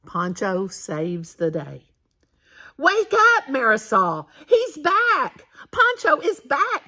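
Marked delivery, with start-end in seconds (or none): 2.8-6.9 high, loud and bursting with news; excited wake-up call. A narrator's reading. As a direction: wake her with joyful news. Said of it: "said Grandfather".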